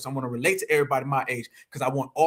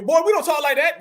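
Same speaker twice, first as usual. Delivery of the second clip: put-on higher, louder scolding of a kid; scolding elder; 10 semitones higher, 5 dB louder than usual. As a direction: snap it like a stern relative. Voices a scolding elder relative.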